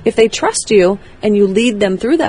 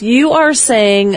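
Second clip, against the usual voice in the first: usual speaking voice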